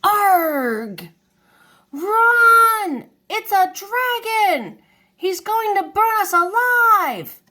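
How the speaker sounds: put-on high, loud panicked scream of a boy; terrified yell. A narrator's reading. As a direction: shriek at the kids to flee from the dragon. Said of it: "yelled one of the young boys".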